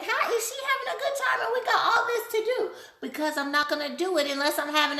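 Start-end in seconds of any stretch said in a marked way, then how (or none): 0.0-2.7 incredulous onlookers. Retold or imitated. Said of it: "everybody's looking at me like".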